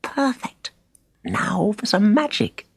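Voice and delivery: high eager delight; bubbling with excitement; excited boy. A narrator's reading. Boy excited to try magic.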